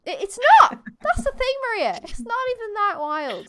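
high-pitched mock protest; playful exasperation. Real, not acted. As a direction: squeal your objection in playful dismay. Mock exasperated answer to co-host.

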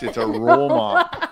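high, loud and slow; insistent. Real, not acted. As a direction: drag it out, laughing. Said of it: "insisting on the name".

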